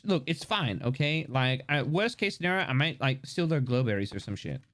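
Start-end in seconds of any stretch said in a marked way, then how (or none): none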